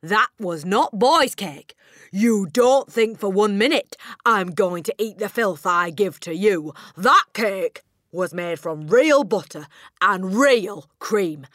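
slow, very loud, outraged accusation; shouting tirade. A narrator's reading. Headmistress accusing the boy of stealing her cake, shouting. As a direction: thunder it out word by word, high and indignant. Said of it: "the Trunchbull shouted".

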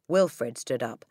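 ordinary delivery

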